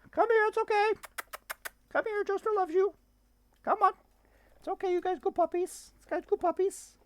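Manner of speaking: very high, sing-song coaxing voice. Real, not acted. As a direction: coo at frightened puppies to come. Sweet talk to scared dogs.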